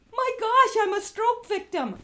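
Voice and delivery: high, loud horrified cry; shocked exclamation. Retold or imitated. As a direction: blurt it out in horror. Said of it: "I looked in the mirror and I went".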